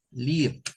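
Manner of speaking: "live" as liv, short i; short i sound. Shown as a demonstration. Teacher writing up the verb, "live" said liv. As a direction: say "live" like the verb, rhyming with give. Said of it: "un sonido corto".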